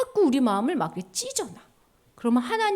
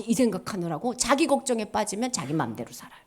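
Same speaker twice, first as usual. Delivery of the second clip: ordinary delivery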